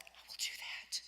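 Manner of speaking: whispered, stunned and grateful; awed whisper. Retold or imitated. Student whispering back to her teacher, awed whisper. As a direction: breathe it out in disbelief.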